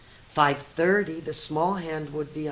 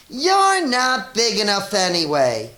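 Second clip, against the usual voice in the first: grouchy ladybug; 5 semitones higher, 9 dB louder than usual. A narrator's reading. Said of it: "the grouchy ladybug said".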